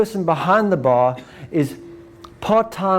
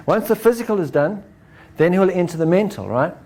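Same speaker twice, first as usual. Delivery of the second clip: ordinary talk, nothing marked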